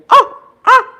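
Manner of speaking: high, loud screeching owl scream; two sharp owl screeches. Retold or imitated. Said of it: "and scream like that".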